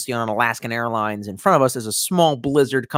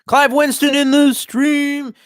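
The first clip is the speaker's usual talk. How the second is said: high, loud, slow announcer-style welcome; excited greeting; 13 semitones higher, 5 dB louder, slower than usual. Real, not acted. Streamer hyping up a viewer who joined, slow high announcer call. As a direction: stretch the name out like a hype host.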